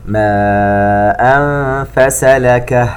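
slow chanted tajweed recitation; Quran recitation. Acted. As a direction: draw out each vowel, chant it. (تلاوة مرتلة بطيئة)

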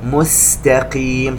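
مستقيم slow, with a crisp light sin; clear sin. Shown as a demonstration. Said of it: "يجب أن تخرج سين". (سين واضحة مرققة في مستقيم)

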